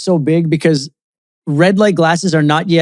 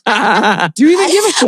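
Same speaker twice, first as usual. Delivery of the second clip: angry shout; 6 semitones higher than usual. Retold or imitated. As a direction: yell it like you were just woken. Repeats the angry wake-up quote.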